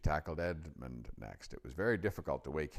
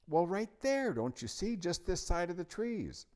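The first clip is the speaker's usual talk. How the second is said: put-on high, urgent little-girl voice; insistent; 5 dB louder than usual. A narrator's reading. Lucy points the lion out to Edmund, insistent. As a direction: make him look, pitched way up.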